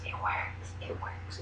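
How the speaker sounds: soft insistent whisper. Real, not acted. Telling viewers the oils work, whispered. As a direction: hush it with conviction. Proud hushed aside on oils.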